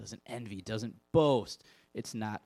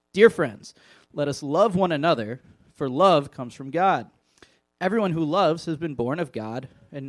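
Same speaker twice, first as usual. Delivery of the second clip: loud, earnest Bible reading; reading scripture aloud with weight; 6 dB louder than usual. Acted. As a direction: proclaim the passage to the room. Scripture reading to youth group.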